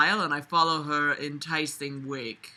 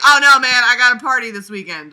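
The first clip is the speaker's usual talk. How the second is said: loud, fast party bro; hammed-up party bro; 7 semitones higher, 13 dB louder than usual. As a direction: brag like a frat guy. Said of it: "he being like".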